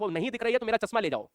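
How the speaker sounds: ordinary talk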